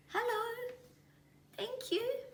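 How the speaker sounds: very high timid chick voice; little kiwi. A narrator's reading. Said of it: "The little Kiwi said".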